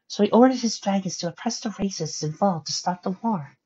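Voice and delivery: high, gentle storytelling character voice. Acted. Pony telling Twilight of Heimdall, high gentle voice. Pony recounts sad history.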